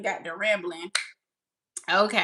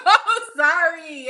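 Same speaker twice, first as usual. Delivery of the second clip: drawn-out, louder, higher sorry; laughing apology; 7 semitones higher, 7 dB louder, much slower than usual. Real, not acted. Host apologises to viewer for lagging behind, laughing. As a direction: laugh through a long apology.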